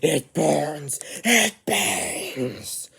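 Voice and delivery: screaming in pain. Acted. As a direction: howl as if gold is searing you. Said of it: "screams in pain and yells".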